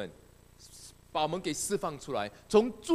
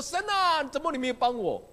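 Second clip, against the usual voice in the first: mock plea; 6 semitones higher, 4 dB louder than usual. Mock complaint to God.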